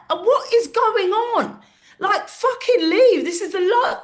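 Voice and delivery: high, loud, slow, half-laughing outrage; exasperated yell. Retold or imitated. Comic telling her heckling friend to leave, high, loud and laughing. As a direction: throw him out through disbelief.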